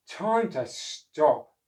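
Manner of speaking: slow, weary decision; tired. Acted. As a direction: done in after mopping. Oscar tired.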